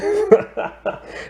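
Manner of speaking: high, breath-filled giggle; amused laugh. Real, not acted. Reviewer laughing at her joke about nuns, amused laugh. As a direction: let a real high laugh tumble out.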